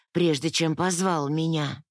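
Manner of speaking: ordinary delivery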